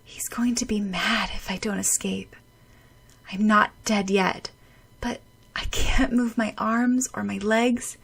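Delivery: breathless whisper. Acted. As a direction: whisper through the cold and dark. Freezing, drowning thoughts.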